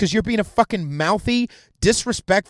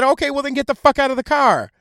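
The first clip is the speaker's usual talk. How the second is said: higher, forceful mock cop outburst; rude cop order; 7 semitones higher, 4 dB louder than usual. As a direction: order her out as a fed-up cop. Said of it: "he didn't say it that way".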